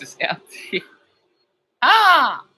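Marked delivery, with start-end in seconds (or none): 1.8-2.4 high loud startled cry; sudden shriek. Real, not acted.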